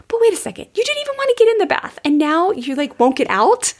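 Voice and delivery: high, fast mock disbelief; a high, fast incredulous squeak of a parent fed up at bath time; exasperated parent. Parent baffled by kid refusing to leave bath, mock exasperated.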